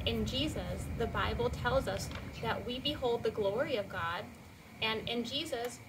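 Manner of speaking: ordinary talk, nothing marked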